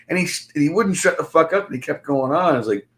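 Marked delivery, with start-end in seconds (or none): none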